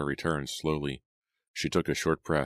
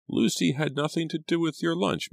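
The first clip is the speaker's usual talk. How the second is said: insistent housekeeper; 13 semitones higher than usual. A narrator's reading.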